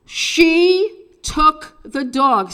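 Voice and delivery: teaching her the line to shout from the window; stage voice call. Shown as a demonstration. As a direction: spell it out loudly for the street. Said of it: "Say these a couple of times".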